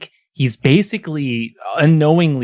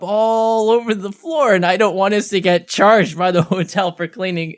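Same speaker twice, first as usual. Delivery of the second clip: a higher, lightly voiced grandmother, fed up; exasperated grandma; 8 semitones higher than usual. Grandma telling her drunk grandson why she's cleaning, lightly voiced and exasperated. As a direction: play the tired grandma setting him straight.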